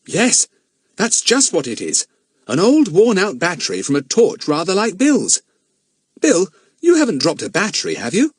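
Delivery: put-on a curious boy checking a find; boy asking Bill about the battery; boy's voice. Philip examines battery.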